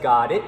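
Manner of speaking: "Got it" with the final T held, not released; unreleased T. Shown as a demonstration. Teacher demonstrating to students an unreleased T. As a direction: stop the tongue on the T of "it" without letting air out. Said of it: "So it's like".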